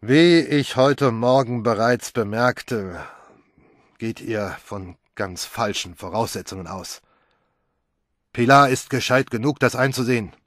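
lightly voiced self-important pronouncement; pompous. A hint of George lecturing the family pompously. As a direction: announce it like a know-it-all. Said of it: "verkündete dann wichtigtuerisch".

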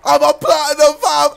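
high, loud boast; triumphant shout. Real, not acted. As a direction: crow it at the top of your excitement. Celebrates an unexpected success.

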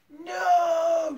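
high, drawn-out scream of no. A narrator's reading. Eric jumping in front of the rifle, screaming. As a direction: scream it long and high in protest. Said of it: "screamed Eric".

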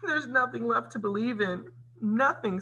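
high, plaintive acted despair; sobbed. A narrator's reading. Cinderella gives up hope in the garden, sobbing. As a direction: lament in a high, tearful storybook voice. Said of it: "Cinderella sobbed".

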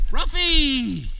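high, loud drawn-out call; calling the dog. Real, not acted. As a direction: call him from far off. Calling puppy.

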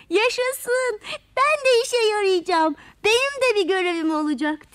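happy little creature cheering at its new job. A narrator's reading. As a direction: shout it out with delight. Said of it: "diye bağırmış".

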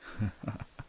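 soft unvoiced villain chuckle under the breath; a quiet, smug laugh through the nose. Villain gloating over a frozen student, hushed chuckle.

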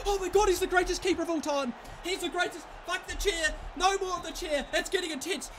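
high, excited gushing; breathless elation. Real, not acted. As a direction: rave about your hero, pitched high. Raving about keeper's save.

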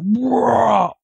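high, drawn-out imitated beast roar; massive roar. Man imitating the unseen thing in the bush, massive roar. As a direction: imitate a huge creature roaring from the chest. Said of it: "this massive, big, like, roar".

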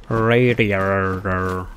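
slow, stretched-out word; drawn out. Acted. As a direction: drag the word out.

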